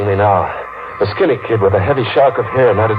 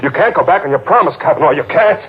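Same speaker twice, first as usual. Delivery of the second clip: desperate insisting; 7 semitones higher, faster than usual. Acted. Pleading, insisting he has won.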